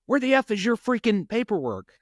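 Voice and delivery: mock yell from the boss about missing paperwork; retold yelling. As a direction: bark it like a fed-up supervisor on the phone. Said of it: "he was kinda yelling at me".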